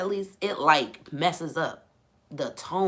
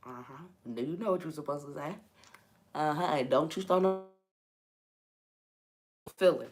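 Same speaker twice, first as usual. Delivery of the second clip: mock mama; 7 dB quieter, faster than usual. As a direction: scold quietly like a watchful mother. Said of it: "she look like she was my mama".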